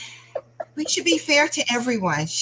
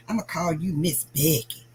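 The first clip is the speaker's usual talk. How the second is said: imitating the student; 4 semitones lower, 3 dB quieter than usual. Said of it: "That's how she said it".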